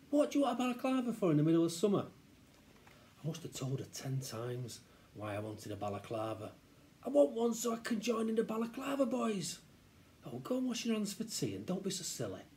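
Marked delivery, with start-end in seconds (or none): 0.1-2.0 high, quick scolding mother; exasperated mum. Acted. Mum refuses child a balaclava, exasperated. 7.0-9.6 put-on high, whiny-kid plea; pleading child. Child explains to mum why he wants one, pleading. 10.2-12.4 lightly voiced scolding mother, higher; dismissive mum. Mum sends child to wash for tea, dismissive.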